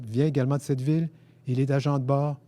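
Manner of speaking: plain reading voice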